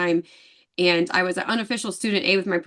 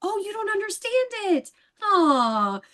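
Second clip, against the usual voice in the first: high, slow, mocking sympathy; mock pity; 11 semitones higher, much slower than usual. Teasing the department head over sign language, a high slow mock pity. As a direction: pretend to pity him, sweetly taunting.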